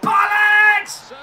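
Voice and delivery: high, loud strained yell; shouted curse. Real, not acted. Player cursing at the match, high frustrated yell.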